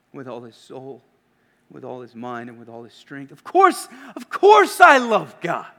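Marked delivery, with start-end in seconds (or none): 3.3-5.7 high, loud affronted insistence; indignant scribe. Retold or imitated. Scribe assuring Jesus of his love for God, affronted. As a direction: bristle at the very question. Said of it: "the scribe likely thought".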